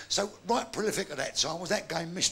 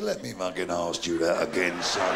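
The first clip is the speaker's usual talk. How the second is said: a much deeper, gruff vicar voice. Acted. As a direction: growl it like a fed-up vicar.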